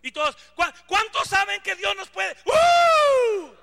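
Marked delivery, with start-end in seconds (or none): 0.5-2.4 loud, higher preacher's rally cry; hammed-up loud preacher; mock hype preacher. 2.4-3.5 high loud whoop; mock worship whoop, high and loud; shout of joy. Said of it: "Grito de júbilo".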